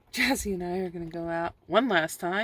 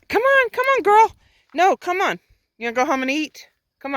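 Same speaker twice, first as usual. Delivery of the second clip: much higher and louder, sing-song call to the dog; 10 semitones higher, 9 dB louder than usual. Real, not acted. Owner calling her dog home, high and loud. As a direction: call her to you from across the field.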